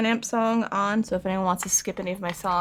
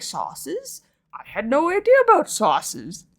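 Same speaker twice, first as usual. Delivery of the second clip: confused self; 7 semitones higher, slower than usual. Retold or imitated. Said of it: "I was just like".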